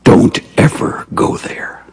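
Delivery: whispered, slow warning; hushed warning. Real, not acted. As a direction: whisper it like a secret caution. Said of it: "preacher's hushed warning".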